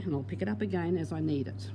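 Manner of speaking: ordinary delivery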